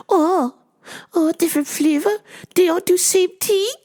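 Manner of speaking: very high mock-accented puzzled customer; high-pitched comic caricature; Miss Swan. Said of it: "Miss Swan".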